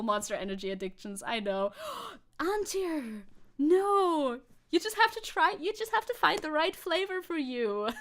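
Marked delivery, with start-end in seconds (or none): 2.1-7.9 high, loud eager pleading; excited protest. Real, not acted. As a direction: rush to defend it, pitched up. Defending Monster Energy playfully.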